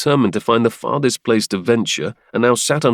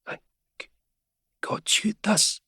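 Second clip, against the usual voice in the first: feverish whisper; 12 semitones higher, 7 dB quieter than usual. Acted.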